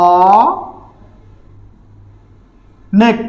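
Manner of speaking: অ held as open "aw", long pause, then নেক; syllable by syllable. Shown as a demonstration. Teacher dictating the spelling of the pronunciation, অ, long pause, নেক. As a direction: sound out অ and নেক separately. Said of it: "উচ্চারণ যখন লিখতে যাবো".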